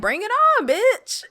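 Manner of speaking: high-pitched, cocky mock challenge; imitated taunting challenge. Alita daring Zapan to fight, mock taunting. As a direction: throw down the gauntlet in an acted high voice.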